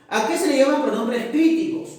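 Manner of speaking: student question. Acted. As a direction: ask it like a keen pupil.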